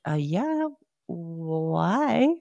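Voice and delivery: imagined baffled reply, stretched rising why; incredulous. Retold or imitated. Answering the clinic's surprise invitation, incredulous. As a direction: play up the puzzled suspicion. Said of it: "I didn't say that to her".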